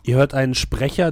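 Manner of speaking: ordinary talk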